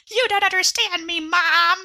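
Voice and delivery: high, hammed-up sulky teenager yelling at mom; teen temper tantrum. Newborn alien throwing a tantrum at its mom, mock teen whine. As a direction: play the alien as a bratty kid storming off. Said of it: "temper tantrum".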